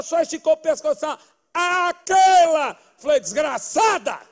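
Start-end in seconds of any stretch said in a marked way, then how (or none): 1.5-2.8 high, slow, loud drawn-out name; loud announcement. Acted. Grandma presenting the girl to her grandson, a loud drawn-out call. 3.2-4.3 mock curse. The grandson cursing his grandma in mock fury, slow and loud.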